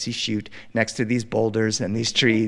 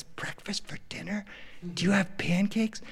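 put-on hushed, high little-boy wonder; imitated kid, barely daring to ask; awestruck child; 5 semitones higher, 9 dB quieter than usual. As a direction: ask it softly, in amazed disbelief. Child awed by diner offer.

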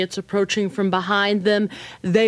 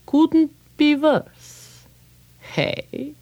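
slow, high, wry repeat; musing rabbi; 8 semitones higher, much slower than usual. Acted. Old rabbi mulling the man's complaint, slow and wry. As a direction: savor the words slowly like an old rabbi.

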